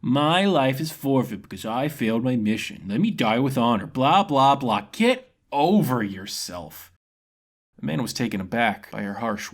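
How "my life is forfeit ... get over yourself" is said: slow, higher sarcastic parody of his solemn words; mock him with exaggerated pomp; woman parroting his gloomy speech, then snapping; mocking impression. Karana mocks the mercenary.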